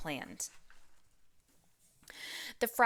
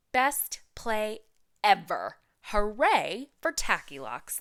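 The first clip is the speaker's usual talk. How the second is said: put-on louder, excited kids' cheer; 4 semitones higher, 6 dB louder than usual. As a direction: cheer like a delighted class. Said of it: "they cheered".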